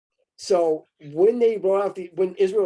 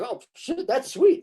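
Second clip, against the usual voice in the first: mock delight; 11 semitones higher, slower than usual. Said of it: "He's like".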